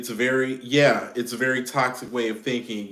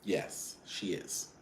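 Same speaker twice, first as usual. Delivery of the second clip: hushed aside; 4 semitones lower, 12 dB quieter, slower than usual. Real, not acted. Hushed confirmation.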